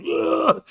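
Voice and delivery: high, loud retching ugh; a sick worker groaning in disgust at pee. Retold or imitated. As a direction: gag in disgust. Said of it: "I'm like".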